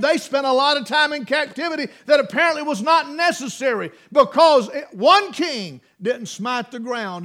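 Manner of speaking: high, loud preaching build; fiery pulpit climb; preaching at full force. Acted. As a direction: preach it out with rising fire.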